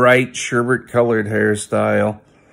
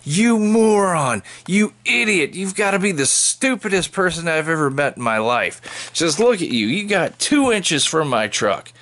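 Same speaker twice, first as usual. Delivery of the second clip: shrieking woman; 8 semitones higher than usual. Retold or imitated. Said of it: "she gets out and she says".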